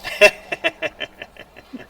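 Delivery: high, light chuckling; amused high giggle; laughing. Real, not acted. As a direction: laugh in a quick run that fades away. Laughs after in-character jab.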